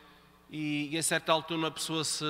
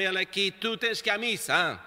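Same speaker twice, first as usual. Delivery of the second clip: ordinary delivery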